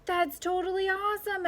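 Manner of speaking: high, thrilled shout; excited shout. A narrator's reading. Edison cheers at the duck in the diner, excited. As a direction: cheer like a delighted kid. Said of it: "Edison shouted".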